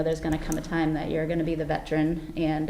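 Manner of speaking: ordinary talk, nothing marked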